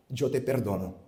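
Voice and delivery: soft, hushed and gentle; tender forgiveness. Retold or imitated. Widow forgiving the man who killed her husband, softly and gently. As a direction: say it softly, like an embrace.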